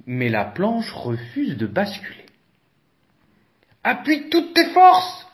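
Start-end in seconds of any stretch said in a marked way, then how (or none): 3.8-5.3 high, loud cheering-on voice; encouraging Elephant. A narrator's reading. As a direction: urge the mouse on with all your heart. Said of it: "l'encourage Éléphant".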